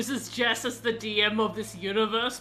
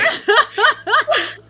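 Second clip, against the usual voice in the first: squealing laugh, much higher and louder; high burst of laughter; 11 semitones higher, 12 dB louder than usual. Real, not acted. Player laughing at a petty teammate, squealing laugh. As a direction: let out a high delighted laugh.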